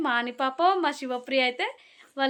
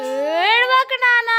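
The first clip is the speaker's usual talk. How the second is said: high, loud coaxing call; 9 semitones higher, 6 dB louder, much slower than usual. Real, not acted. Consoling a crying child. (గట్టిగా, పై స్వరంలో బుజ్జగింపు)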